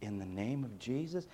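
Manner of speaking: quiet, sarcastic pious phrase; lightly mocking churchy tone. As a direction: mutter "In the name of Jesus" as an empty formula. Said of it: "had the audacity to say".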